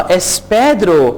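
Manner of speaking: es Pedro drawn out slowly, each syllable clear; slow, careful es Pedro for repeating; slow model phrase. Shown as a demonstration. Teacher slowly models es Pedro for learners. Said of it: "आ".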